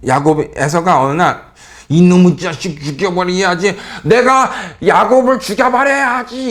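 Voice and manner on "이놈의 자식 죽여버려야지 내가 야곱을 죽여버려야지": hammed-up murderous threat, higher and slow with a laugh; overdone villain. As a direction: ham up a vengeful brother's threat, cackling. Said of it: "이렇게 오버는 안 했을 거예요".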